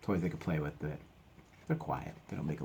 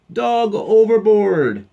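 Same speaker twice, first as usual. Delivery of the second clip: high, very loud, drawn-out cry; alarm shout; 17 dB louder, much slower than usual. Acted. Crew seeing a dog jump, long loud alarm. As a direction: raise the alarm across deck.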